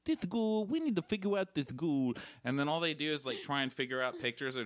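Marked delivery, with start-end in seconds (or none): 0.0-2.1 higher, put-on accent for the TV detective; mock the detective's fake accent; hammed-up cartoonish cop voice. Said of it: "It's like a cartoon voice".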